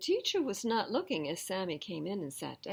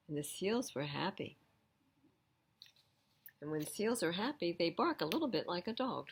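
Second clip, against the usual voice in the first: ordinary delivery, nothing marked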